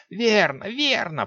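high pleased sorceress; pleased muttering witch. A narrator's reading. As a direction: murmur approval like a witch. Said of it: "пробормотала та, довольная".

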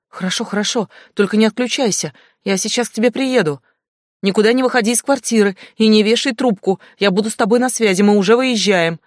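высоко и громко, торопливо успокаивает; уговаривай испуганную подругу, спеша; взволнованно по телефону. Acted. (Глаша успокаивает подругу по телефону, взволнованно)

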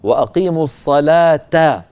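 slow recitation, الصلاة ending in -ta; joined t ending. Shown as a demonstration. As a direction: say الصلاة as "as-salaata". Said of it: "في الوصل نقول".

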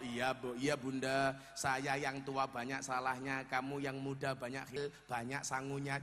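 low, soft elder's answer; mock solemn reply. Acted reply to formal apology. (jawaban khidmat, pelan dan rendah)

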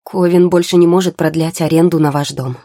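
громко выпалить неприятную новость; выпалить на одном дыхании, громко. A narrator's reading. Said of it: "выпалил парень". (громко, выпаливая)

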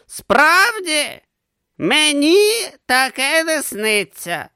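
put-on high, slow old philosopher. A narrator's reading. Didactylos answering Urn, high and slow. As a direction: drawl it out as the old teacher. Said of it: "зізнався Дидактилос".

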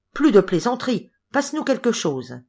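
ordinary delivery, nothing marked

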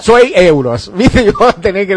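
laughing at the vendor's joke. Real, not acted. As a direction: tell it while cracking up.

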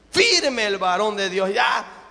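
normal talking voice

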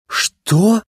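high-pitched, puzzled question; bewildered. A narrator's reading. Young racer lost by Sally's offer, bewildered. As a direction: ask it thrown off, pitch leaping up. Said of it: "спортсмен действительно растерялся".

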